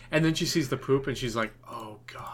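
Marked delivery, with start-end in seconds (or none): 1.7-2.3 a horrified whisper; imitating wife's hushed horror; whispered oh God. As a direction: breathe it out in disgust. Wife sees the poop.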